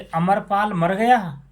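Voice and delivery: sharp high startled question; can't believe a man named Amarpal died; shocked disbelief. Retold or imitated. Man hearing the dead is Amarpal, asking in shock.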